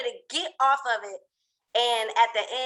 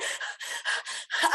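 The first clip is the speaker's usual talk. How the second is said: quick, voiceless panicky breaths; panicked panting; 5 dB quieter than usual. Retold or imitated. Climber frozen before the first step, panicked panting. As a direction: act out hyperventilating.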